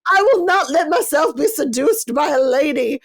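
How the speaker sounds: a hammed-up stuffy lady refusing; Cora's haughty vow. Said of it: "Cora's like".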